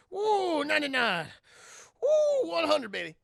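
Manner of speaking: loud, high workout whoops; pumped-up whooping. Retold or imitated. Bo counting reps as he works out, pumped-up.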